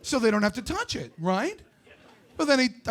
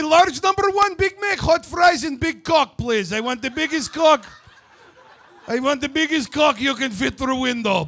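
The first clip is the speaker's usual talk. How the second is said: insistent, louder mock Russian accent; put-on Russian accent; 3 semitones higher, 4 dB louder than usual. Customer repeating the order to the worker, insistent Russian accent. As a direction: demand it in a hammed-up Russian accent. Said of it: "put on some fucking voice".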